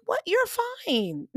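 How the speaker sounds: high, squeaky incredulous "what"; incredulous reassurance. Retold or imitated. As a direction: brush off his worry in disbelief. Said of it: "I'm like".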